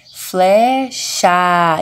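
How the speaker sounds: FLECHA as FLÉÉ-CHAAA, both vowels held long; forced vowels. Shown as a demonstration. Teacher stressing vowels for pupils, stretched FLECHA. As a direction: lean hard on each vowel of FLECHA. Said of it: "Eu forço mais na vogal".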